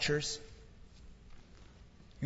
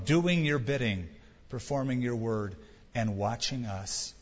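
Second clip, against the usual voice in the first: closing prayer; 3 semitones lower than usual. Real, not acted.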